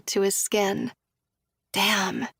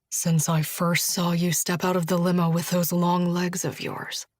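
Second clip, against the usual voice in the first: slightly lower, intimate male voice; a hint of a low seductive man; lightly voiced lover; 3 semitones lower than usual. A narrator's reading. Narrator voices Josh, lower.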